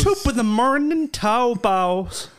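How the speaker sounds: mocking bad Irish. Co-host mimics her Irish imitation, mangled mock Irish. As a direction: send up a botched Irish accent.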